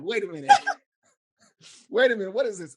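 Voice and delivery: mock disbelief. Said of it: "And I'm like".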